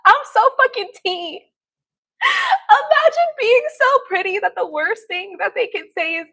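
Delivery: high, loud, giddy crowing; gleeful bragging. Real, not acted. Streamer flattered by a troll's insult, giddy bragging. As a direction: boast loudly, buoyed up by laughter. Elated bragging between laughs.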